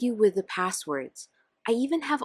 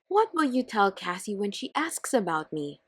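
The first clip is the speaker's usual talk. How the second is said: ordinary talk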